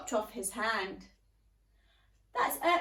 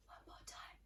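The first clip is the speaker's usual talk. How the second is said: faint whisper; 23 dB quieter, faster than usual. Shown as a demonstration. Teacher continuing the exercise in a faint whisper. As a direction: breathe the words out. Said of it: "a bit quieter".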